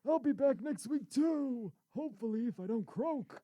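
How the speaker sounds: high, slow, creaky grandpa voice; old man voice. Pretend. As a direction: play a frail old grandpa, slowly. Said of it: "Thanks, Grandpa".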